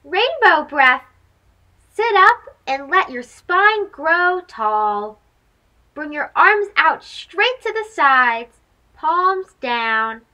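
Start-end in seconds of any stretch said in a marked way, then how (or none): none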